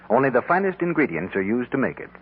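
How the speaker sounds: ordinary delivery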